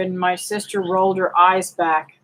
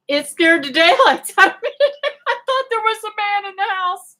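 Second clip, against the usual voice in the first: laughing; 12 semitones higher than usual. Real, not acted. Laughing at her own fright.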